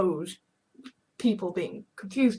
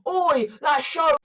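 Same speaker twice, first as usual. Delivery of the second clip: slow, high, loud mock complaint; a hammed-up yelled complaint; complaining shout; 7 semitones higher, 6 dB louder than usual. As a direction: shout the grievance out. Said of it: "So complaining".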